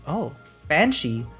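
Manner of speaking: ordinary talk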